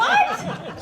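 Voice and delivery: high laughing shriek of disbelief; shocked what. Retold or imitated. Client shocked mid-wax, high laughing what. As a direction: squeal it in disbelief. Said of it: "I'm like".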